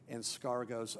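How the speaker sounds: ordinary talk, nothing marked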